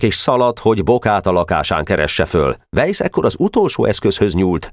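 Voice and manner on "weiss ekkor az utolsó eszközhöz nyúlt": ordinary delivery, nothing marked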